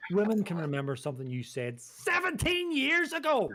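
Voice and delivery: loud, high teasing shout. Real, not acted. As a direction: belt it out, half joking. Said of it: "Sorry if I shouted".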